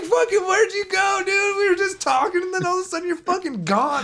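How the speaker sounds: very high, incredulous squeal; retold disbelief. To a friend who vanished mid-talk, mock-incredulous.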